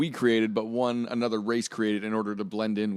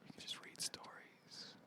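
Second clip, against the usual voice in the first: slow ASMR whisper; 27 dB quieter, slower than usual. Shown as a demonstration. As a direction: breathe the words out slowly. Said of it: "just whisper".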